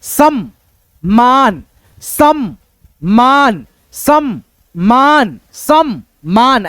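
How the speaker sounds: सम्मान very slowly, in two beats, सम्-मान; joined double m. Shown as a demonstration. Teacher reading the joined letters for students, सम्मान slow with doubled m. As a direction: hold the म् of सम्मान, then a long falling मान. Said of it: "जोड़ करके पढ़ेंगे".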